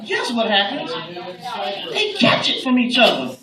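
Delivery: ordinary talk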